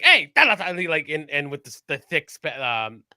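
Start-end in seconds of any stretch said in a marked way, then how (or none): 0.0-0.6 mock yell. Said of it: "Bolo would be like".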